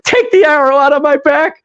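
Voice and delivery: high, urgent imitated begging; hammed-up high-pitched plea; mock pained plea. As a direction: beg for help like a wounded man. Wounded man's plea in retold scene.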